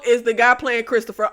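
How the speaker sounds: usual speaking voice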